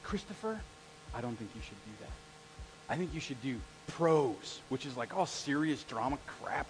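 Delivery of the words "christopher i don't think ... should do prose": low, soft and slow, like a disapproving teacher; a hint of bad news in the full name; quiet stern teacher voice. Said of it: "anytime someone calls me Christopher, you know it's like bad news".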